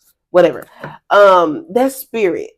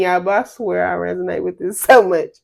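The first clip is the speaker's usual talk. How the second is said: normal talking voice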